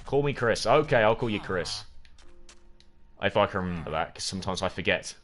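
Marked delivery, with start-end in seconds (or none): none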